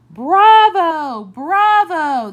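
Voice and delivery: loud, high bravo shouts; cheering crowd. Acted. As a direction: cheer it out like a thrilled audience. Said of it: "they cheered".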